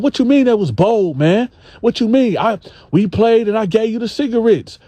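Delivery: high, indignant mock retort; Gene voiced indignant. Gene snapping back at the dude over cigarettes, high and indignant.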